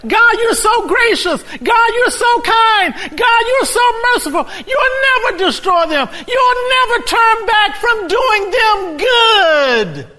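high, loud shouted praise; preacher voicing Jonah. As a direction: cry it out to God at full force. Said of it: "he said".

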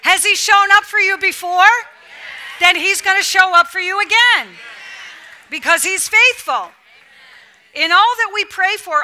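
loud, high, driving sermon; full-force preaching. Acted. Preacher rallying the congregation to keep believing, loud and driving. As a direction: preach it hard to the room.

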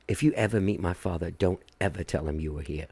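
low, quiet warning, lightly imitated. [name]'s daughter warns the band about her father, quiet low imitation. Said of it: "you could tell it was [name]'s daughter".

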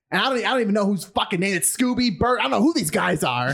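high-pitched exasperated imitation; worked-up complaint to the police, pitched high; retold frustration. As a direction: sputter in mock exasperation. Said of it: "he started getting really frustrated".

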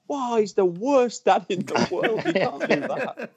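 slow, high, laughing fake shock; mock outrage. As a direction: ham up prudish tutting through a laugh. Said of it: "there'd be people going".

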